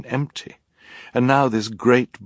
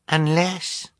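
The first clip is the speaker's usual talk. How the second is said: put-on baby voice; 10 semitones higher than usual. Narrator voices baby Sunny.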